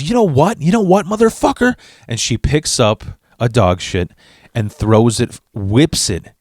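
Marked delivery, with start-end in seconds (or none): 0.0-1.7 high, screeching angry mom voice; mock shrill yelling mother. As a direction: shriek it like a furious trashy mom. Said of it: "And she goes".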